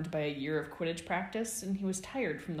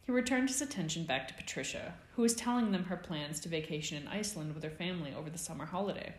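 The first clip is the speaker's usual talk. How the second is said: plain reading voice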